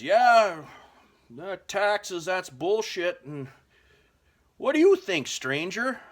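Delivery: loud, high, booming big-guy voice; gruff lumberjack. Retold or imitated. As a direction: bellow it like a drunk burly lumberjack. Big lumberjack demanding an answer.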